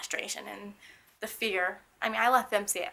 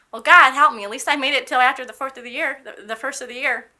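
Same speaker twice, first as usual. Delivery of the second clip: retold wisecrack, loud and pitched up; off-the-cuff joke; 4 semitones higher, 13 dB louder than usual. Wheelchair patient joking with the hospital staff, loud jokey exclaiming. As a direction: crack the joke big and loud. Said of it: "crack a off the cuff joke".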